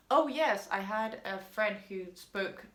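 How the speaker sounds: ordinary talk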